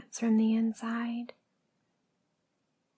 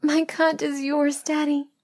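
higher-pitched, urgent answer; strained submissive reply; 5 semitones higher, 3 dB louder than usual. Acted. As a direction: answer in a raised, pleading pitch. Acted answer during paddling.

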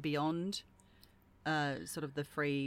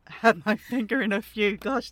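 giggling through the words; laughing; 5 semitones higher, 8 dB louder than usual. Real, not acted. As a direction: laugh while you talk. Laughing at own pun.

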